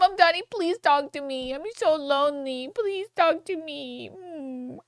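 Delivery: put-on high, soft, childish whimper; mock the rich guy as a kid begging his parents; mock lonely child. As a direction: whine like a lonely little kid. Mocking guest as lonely child.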